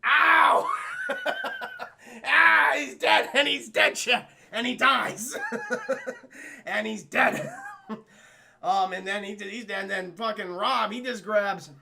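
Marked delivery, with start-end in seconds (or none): 0.0-0.7 loud, high "ow"; sudden drawn-out yelp; yelp of pain. Real, not acted. Falls over while acting the death. 1.1-8.0 loud, raised voice breaking into laughter; cracking up between phrases; laughing. Real, not acted. Cracks up after his own performance.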